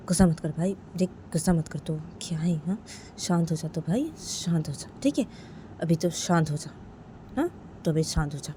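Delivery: hushed, calming voice; quiet coaxing to settle an angry cousin; soothing. Acted. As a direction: talk him down softly. Cousin calms the angry Sanju.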